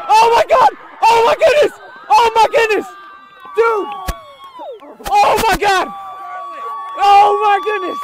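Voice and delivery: high loud thrilled yelling; excited screaming. Real, not acted. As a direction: scream with disbelief and joy. Wild excitement at interception.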